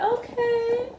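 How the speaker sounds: imitating a pleased mother, way up high; high-pitched smiling okay; happy, smiling. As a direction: put on a delighted mother's high voice. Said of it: "she was smiling. Like, she was happy".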